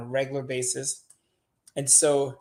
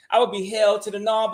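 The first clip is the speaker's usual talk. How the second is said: ordinary talk, nothing marked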